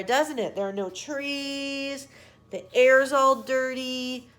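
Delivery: plain reading voice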